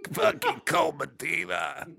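mock movie-line impression; impression of Cole Medina line. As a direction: mimic the line in a silly high voice. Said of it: "do an impression of what you think it sounded like".

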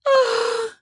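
high, breathy sigh of pleasure; high feminine sigh. Acted. Kelly sighing high under his touch. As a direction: let out a long high sigh. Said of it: "She sighed her sweet feminine sigh".

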